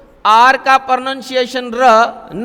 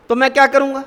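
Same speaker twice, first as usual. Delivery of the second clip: ordinary delivery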